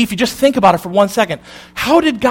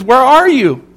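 a hint of God calling higher through the garden; 6 semitones higher, 3 dB louder than usual. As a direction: call out searching, lightly voiced. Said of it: "and he says".

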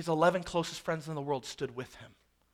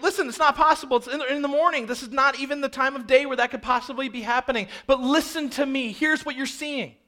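loud, high, urgent explaining; insistent Peter; 7 semitones higher, 5 dB louder than usual. Acted. Peter answers the crowd's charge of drunkenness, loud insistent rebuttal. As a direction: plead the case over a noisy crowd. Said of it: "But he's like".

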